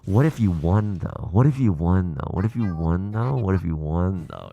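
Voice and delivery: low goblin whisper of temptation repeated; mind goblin voice. Retold or imitated. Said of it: "the Mind Goblin in me, which is like".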